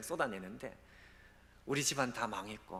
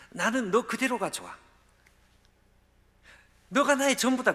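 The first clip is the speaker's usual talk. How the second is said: impassioned preaching; 11 semitones higher, 12 dB louder than usual. Acted. Preacher climaxes God's words.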